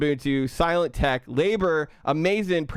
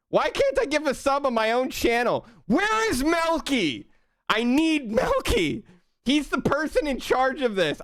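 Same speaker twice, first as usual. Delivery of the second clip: exasperated; 11 semitones higher than usual. Real, not acted. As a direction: complain in disbelief. Frustrated can't gift sub.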